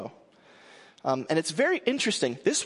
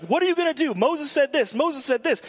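much higher, insistent imitation of hecklers; mock badgering shouts; 11 semitones higher, 4 dB louder than usual. Crowd pressing Jesus while he keeps writing, mock shouting. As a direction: pester him in a raised, high-pitched voice. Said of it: "like, shouting at him".

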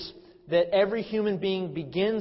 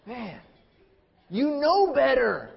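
loud, high, drawn-out parental reproach; slow, high exasperated outburst; exasperated scolding; 7 semitones higher, 6 dB louder, slower than usual. Retold or imitated. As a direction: cry out like a dad at wit's end. Exasperated father scolds son.

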